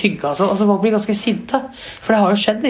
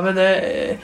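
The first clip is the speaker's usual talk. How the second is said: ordinary delivery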